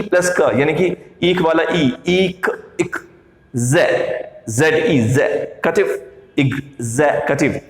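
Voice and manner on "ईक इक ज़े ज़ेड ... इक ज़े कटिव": एग्जीक्यूटिव built slowly from इक, जे, कटिव; syllable-by-syllable pronunciation. Shown as a demonstration. Teacher splitting the word into three parts, slowly enunciated. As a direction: spell out each part, then join as ik-ze-kativ. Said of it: "इसके तीन हिस्से होंगे".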